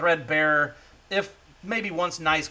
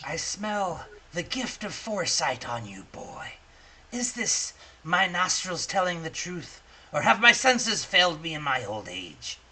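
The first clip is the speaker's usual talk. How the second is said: a hint of a crone, softer, confiding; old crone; 5 dB quieter than usual. Old crone questioning the boy about foresight, lightly voiced. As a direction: lean in like a sly old hag testing him. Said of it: "she says".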